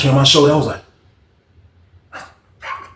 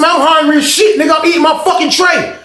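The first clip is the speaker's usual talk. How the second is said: high, loud dare to the room; shouting a challenge to the whole cafeteria; high challenging shout; 6 dB louder than usual. Retold or imitated. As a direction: square up to everyone. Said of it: "I said".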